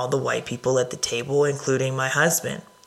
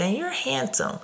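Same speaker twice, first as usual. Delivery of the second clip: sweet; 11 semitones higher, 5 dB quieter than usual. Retold or imitated. She compliments the boy.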